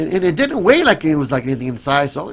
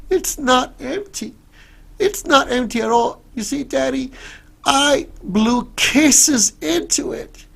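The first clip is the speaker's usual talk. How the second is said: put-on little girl voice; 11 semitones higher than usual. Little girl answers sobbing father.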